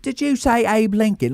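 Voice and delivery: put-on high old English washerwoman voice; English washerwoman accent. Shown as a demonstration. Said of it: "like an old washerwoman".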